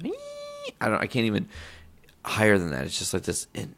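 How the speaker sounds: ordinary talk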